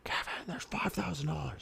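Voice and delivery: high-pitched, hushed mock yell; freaking out. Wife confronts husband over missing money, mock freaking out. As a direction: imitate an exasperated wife, voice held down. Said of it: "freaking out".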